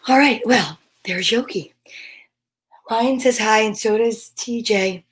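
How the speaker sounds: normal talking voice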